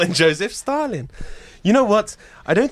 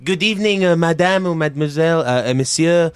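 courteous French maître d'; hesitant, polite French host; French waiter; 4 semitones higher than usual. Acted. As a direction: welcome guests in a French accent. Plays French restaurant host.

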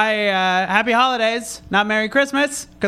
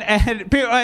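usual speaking voice

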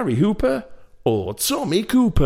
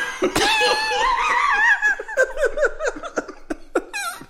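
high laugh. Real, not acted. Breaks up laughing.

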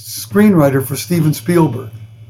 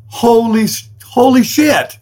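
higher, slow astonished cry; amazed exclamation; 6 semitones higher, slower than usual. Retold or imitated. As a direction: let the amazement stretch it out, pitched up. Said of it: "years later going".

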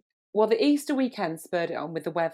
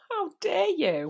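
slow, higher mock indignation; mock outrage; 12 semitones higher, slower than usual. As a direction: play at being scandalised. Mock outrage over First Dates.

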